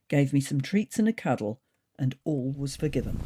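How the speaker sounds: ordinary delivery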